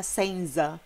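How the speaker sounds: SENZA with a plain buzzing Z, no T; mispronounced Z. Shown as a demonstration. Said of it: "Não vai ser nunca". (senza com Z de português, errado)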